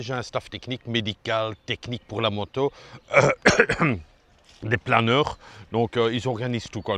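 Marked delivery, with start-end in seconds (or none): 2.9-4.0 dry cough. Real, not acted. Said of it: "j'ai un chat dans la gorge là".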